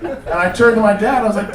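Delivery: ordinary talk, nothing marked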